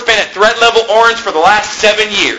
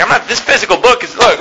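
ordinary talk

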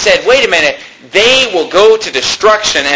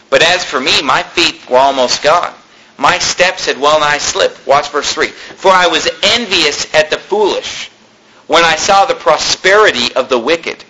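measured King James reading, lower than his talk; scripture reading; 4 semitones lower than usual. Acted. Preacher reading the psalm to his congregation, solemn scripture reading. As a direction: read the psalm aloud to the congregation, unhurried.

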